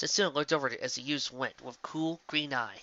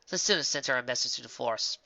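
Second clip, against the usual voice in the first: plain reading voice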